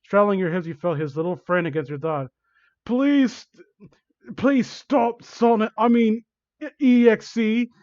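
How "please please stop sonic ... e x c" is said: high, loud, pleading cry; shouted out. Acted. Victim begs villain to stop, shouted. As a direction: cry out in mock panic. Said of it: "I shouted out".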